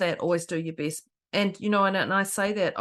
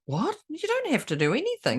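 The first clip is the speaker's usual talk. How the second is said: high-pitched incredulous reply; mock-baffled objection; retold disbelief; 8 semitones higher than usual. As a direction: act out astonishment, pitch jumping up. Acting own astonished reply to girl.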